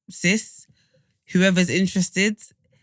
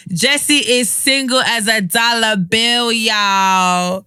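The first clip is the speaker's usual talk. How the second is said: slow, crooning, higher and louder, drawn out; hammed-up smooth pitch man over music; smooth radio host; 5 semitones higher, 7 dB louder, slower than usual. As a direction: sell him like a late-night love-line DJ. Hyping a friend as single.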